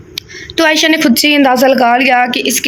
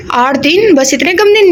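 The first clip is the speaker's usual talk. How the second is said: higher, disbelieving at such short notice; taken aback, pitch jumping up; surprised; 5 semitones higher than usual. Acted. Bride-to-be learns the wedding is only eight days away, shocked. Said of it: "रुबाब हैरान हुई।".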